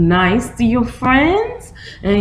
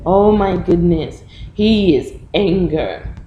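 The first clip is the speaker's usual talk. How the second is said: usual speaking voice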